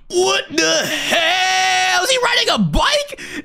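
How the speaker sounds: high drawn-out disbelief; shocked yell. Real, not acted. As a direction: shout in stunned disbelief. Sees monster on bike.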